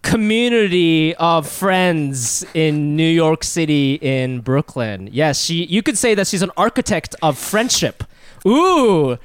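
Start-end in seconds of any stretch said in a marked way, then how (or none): 0.0-5.1 announcer voice. Acted. Grand guest introduction.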